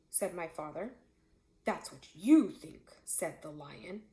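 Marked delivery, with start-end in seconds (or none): 1.7-4.0 menacing lion. A narrator's reading. Lion threatens my father.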